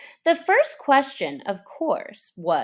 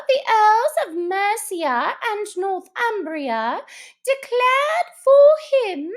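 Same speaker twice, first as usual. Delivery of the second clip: resume the dull lecture grandly; pompous Mouse; 11 semitones higher, 6 dB louder than usual. Acted. Mouse resuming the history lesson, pompous and high. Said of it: "Mouse resumes lecture".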